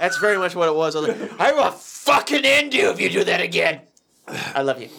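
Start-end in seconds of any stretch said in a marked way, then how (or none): 1.4-3.8 raised, emphatic retold threat; mock threat. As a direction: act out waking fury.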